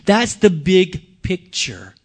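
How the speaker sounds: slow, weighty line; slow emphasis. Acted. Preacher to congregation, slow and weighty. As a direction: land it slowly, each word placed.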